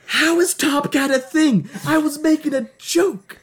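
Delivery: very high-pitched, baffled disbelief; incredulous protest. Real, not acted. Joker learning the made-up cat is real, incredulous protest. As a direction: protest in a high, astonished squeal. Shocked the invented name is real.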